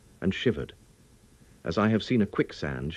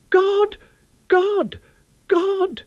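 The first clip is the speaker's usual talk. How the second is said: piercing, much higher cries of God; loud cries; 8 dB louder, much slower than usual. Acted. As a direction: cry out to God at the top of the voice. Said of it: "had called out loudly several times".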